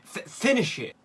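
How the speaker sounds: high, pleading push to score; will the shot in; urgent shout. Real, not acted. Urging player to score, louder.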